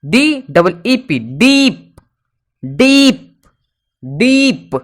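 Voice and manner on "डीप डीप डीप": डीप with a drawn-out ee, higher; teacher modelling the long ee in डीप. Shown as a demonstration. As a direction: stretch the ee of डीप. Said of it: "डीप मतलब होता है गहरा".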